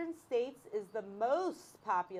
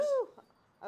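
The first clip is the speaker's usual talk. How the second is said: a much higher, gleeful woo-hoo; excited whoop; 3 dB louder than usual. Real, not acted. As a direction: cheer the game open with a high whoop. Cheering the trivia kickoff.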